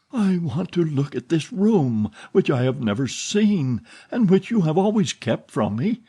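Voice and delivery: put-on a frightened girl feigning lightness; forced playful tone. A narrator's reading. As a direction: cover the fear with playfulness. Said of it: "in a tone which she tried to render playful".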